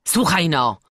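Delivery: loud call for attention; firm, loud master; speaking up loudly. A narrator's reading. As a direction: raise the voice to the boy. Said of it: "mówił dalej głośno".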